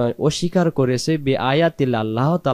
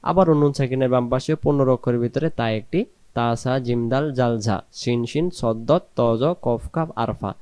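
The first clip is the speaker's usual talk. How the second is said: ordinary delivery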